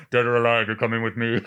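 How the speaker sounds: loud, commanding robot-cop line; RoboCop. Acted. Iron Man threatening Captain America, mock robot-cop command. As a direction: deliver a film catchphrase as an armored lawman. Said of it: "the Iron Man Robocop".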